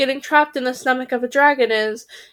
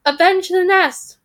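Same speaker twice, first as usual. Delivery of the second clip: high, loud, slow rallying cry; battle cry; 8 semitones higher, 5 dB louder than usual. A narrator's reading. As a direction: cry vengeance, drawing it out. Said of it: "cried the third raven".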